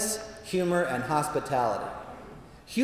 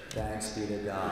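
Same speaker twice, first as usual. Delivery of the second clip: deadpan church response; 7 semitones lower, 10 dB quieter than usual. Retold or imitated. Mocking flat church response.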